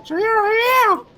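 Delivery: strained very high falsetto voice; squeaky shopkeeper, voice straining; Happy Mask Salesman. Acted. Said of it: "I don't like it".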